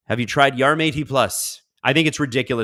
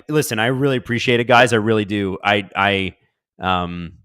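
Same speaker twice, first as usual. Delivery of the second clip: ordinary talk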